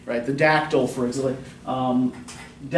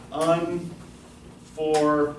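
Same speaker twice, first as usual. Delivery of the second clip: very slow, stretched unfor-, stress on the first beat; scanning the word; 2 semitones higher, 3 dB quieter than usual. Shown as a demonstration. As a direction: draw out unfor- to find the beat. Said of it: "let's actually scan that out".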